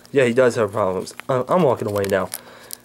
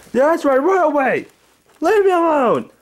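put-on high, loud, cranky old man yelling; Angry Grandpa voice; 3 dB louder than usual. Old man woken by pranksters, high cranky yelling. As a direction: bark at the intruders like a furious grandpa.

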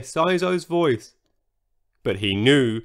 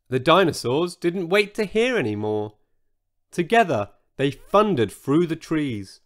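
ordinary delivery

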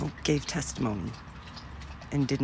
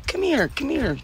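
higher, beckoning call; imitating lady; 12 semitones higher, 4 dB louder than usual. The card lady calling her over, a high beckoning call. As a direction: wave her over eagerly. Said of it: "she said".

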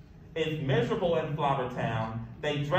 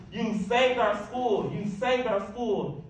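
jubilant roar; 7 semitones higher than usual. A narrator's reading. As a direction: cheer it out overjoyed. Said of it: "he jubilantly roared".

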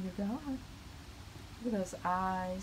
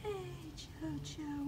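much higher, softer and slow cooing; 8 semitones higher, 8 dB quieter, slower than usual. Real, not acted. Cooing to cat.

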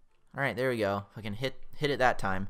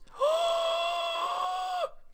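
drawn-out, high shrieking gasp; long high gasp. Real, not acted. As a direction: suck in a long, squealing breath. Tense moment in game.

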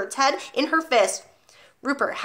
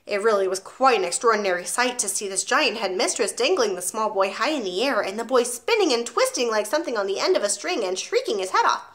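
plain reading voice

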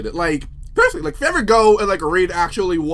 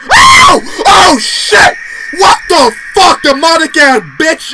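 startled yell, much higher and louder; scared shout; 7 semitones higher, 12 dB louder, much slower than usual. Real, not acted. Streamer jumps at a ghost, screaming in fright. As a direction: shriek at the jump scare.

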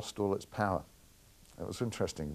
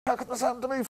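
much higher wailing mock voice; hammed-up anguished whine; imitating James in despair; faster than usual. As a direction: whine it up high like a director in despair. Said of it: "and James was going".

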